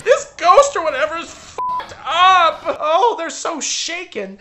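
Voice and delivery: high, loud hammed-up outrage; mock outrage. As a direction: overblown fake panic.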